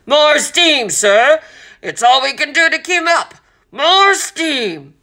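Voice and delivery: high, loud captain's bellow. A narrator's reading. Captain calling down a voice tube to the engine room, bellowing. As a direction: bellow it down to the crew below. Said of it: "bellowed to the engine room below".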